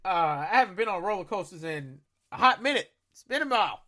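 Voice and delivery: ordinary delivery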